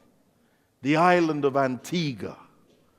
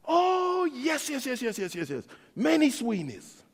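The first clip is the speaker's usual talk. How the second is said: high yes yes fading softer; eager agreement trailing off; eager Irish local; 7 semitones higher, 4 dB quieter than usual. Retold or imitated. Irish locals answering about Sweeneys, eager then fading.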